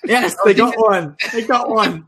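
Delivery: high, loud excited cheer. Real, not acted. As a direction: celebrate the point with a laugh. Cheering a correct answer.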